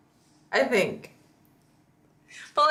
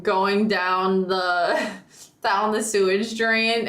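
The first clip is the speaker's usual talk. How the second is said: ordinary talk, nothing marked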